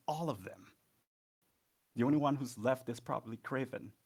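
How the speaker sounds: enraged, hurt. Acted. Son confronts his mother over his lost siblings, enraged. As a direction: hurl the questions in rising, anguished anger. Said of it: "acting out of rage".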